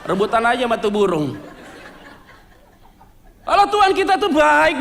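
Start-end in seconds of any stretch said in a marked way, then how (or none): 3.4-4.8 slow, loud, laughing praise; joyful laughing cry that God is good; laughing exclamation. Real, not acted. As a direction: laugh it out to the whole church. Exclaiming God's goodness to congregation.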